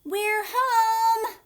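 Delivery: high, loud sing-song yell; shouting. Acted. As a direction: burst in the door calling out.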